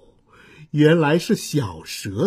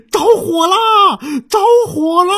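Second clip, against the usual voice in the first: much higher, louder cry of alarm; shouting that there's a fire; 13 semitones higher, 6 dB louder than usual. Acted. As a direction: yell out the fire warning. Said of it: "他们大声喊起来".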